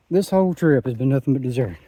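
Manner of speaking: ordinary delivery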